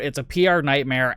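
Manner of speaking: ordinary talk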